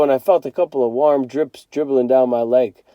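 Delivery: plain reading voice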